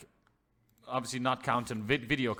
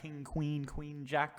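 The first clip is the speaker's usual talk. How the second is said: ordinary talk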